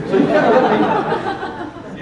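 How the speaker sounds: high, breathy laugh through the words; words breaking into a laugh. Real, not acted. Speaker laughing while telling the audience the story.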